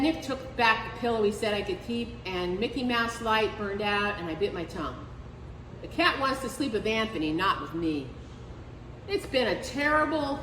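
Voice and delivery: ordinary delivery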